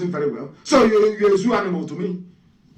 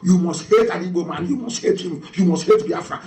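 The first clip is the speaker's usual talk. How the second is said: ordinary delivery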